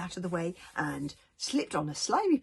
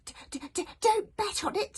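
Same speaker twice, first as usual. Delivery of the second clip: high squeaky stutter without conviction; stammering unconvinced dragon; 14 semitones higher than usual. Acted. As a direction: stammer it out, not believing a word. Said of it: "without a lot of conviction".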